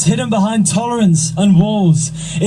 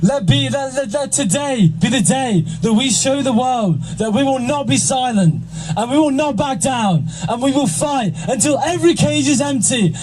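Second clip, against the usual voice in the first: rally speech; 5 semitones higher, 4 dB quieter than usual. Acted. Closing rally speech, builds higher.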